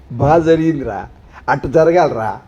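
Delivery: a hint of a gloating onlooker; mock gloating. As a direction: mimic someone pleased at another's misery. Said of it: "అని నవ్వుతుంటారు కదా".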